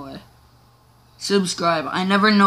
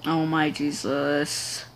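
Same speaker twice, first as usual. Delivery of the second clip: slow drawn-out oh my Jesus; 4 semitones lower, 4 dB quieter, much slower than usual. Real, not acted.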